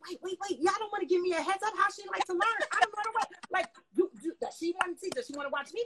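high, loud, fast panicked pleading. Retold or imitated. Dancer panicking before teaching [name], rushed pleading. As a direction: plead in a rush, freaking out. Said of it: "I was freaking out".

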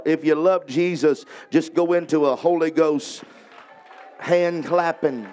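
prayer building from firm to loud and high; fervent prayer. Real, not acted. Preacher praying over the people, building to loud fervor. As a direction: pray with rising fire.

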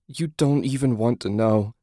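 a touch of tearful dread; understated sob. A narrator's reading. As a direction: say it as if holding back sobs. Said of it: "she sobbed".

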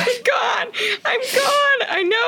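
high, cracking wail through tears; real crying. Real, not acted. Recalling the goodbye scene, she cries out in a high, breaking voice. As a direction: let the voice break as you cry. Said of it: "I'm crying right now".

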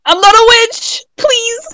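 high, loud pleading cry; mock panicked plea. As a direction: beg not to be burned, hammed up. Pleading mock accused witch.

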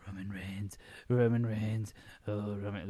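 put-on menacing chant of a name; imitating wrestler Rowan. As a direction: intone it like a backstage threat. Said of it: "where he's like".

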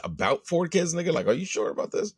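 high-pitched disbelieving echo; incredulous questioning. Acted. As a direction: question it as if you can't believe it. Mock questioning a vague answer.

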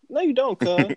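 high, sassy brush-off; mock retort. Answering liberal slogans, a high sassy brush-off. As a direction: swat the claim away with a high voice. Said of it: "I'm like".

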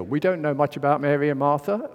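ordinary talk

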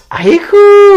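high mock Korean exclamation; mock whiny aiku; imitating Korean mom. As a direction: squeal it like a mom on the phone. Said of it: "it would just be sounding like they're like".

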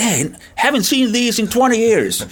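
high, slow, amazed English; imitating New Zealanders. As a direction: play the stunned old climber, drawn out. New Zealanders greet the chocolate. (korkealla äänellä hitaasti ihmettelevä uusiseelantilainen)

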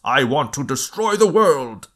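loud, high mock world-destroyer declaration; mock villain line. Podcaster parodying an over-the-top motive, hammed-up villain.

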